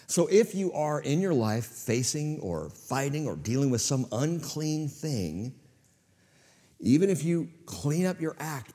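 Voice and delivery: ordinary talk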